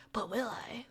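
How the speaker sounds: dramatic unvoiced whisper. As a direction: breathe it like a secret. Dramatic aside.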